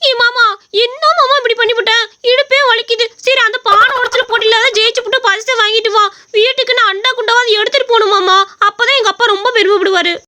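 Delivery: put-on young wife pleading with her husband to hurry; whiny young woman. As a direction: nag him to hurry, complaining of her aching hips. Voices the wife Godavari.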